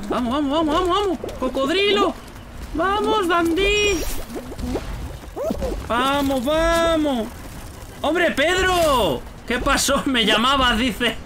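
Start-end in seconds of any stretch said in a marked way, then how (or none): 0.1-7.3 high-pitched cheering; high, eager game cheer; excited urging. Real, not acted. Cheering on during play. 8.0-9.2 high, drawn-out hail; long, high welcoming call; hearty greeting. Real, not acted. Greets Pedro joining the chat.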